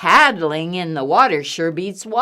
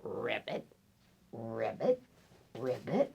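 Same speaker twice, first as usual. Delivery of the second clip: low, soft croaks flicking up; hushed froggy croaks; frog ribbit; 9 semitones lower, 14 dB quieter than usual. Acted. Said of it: "Something that goes".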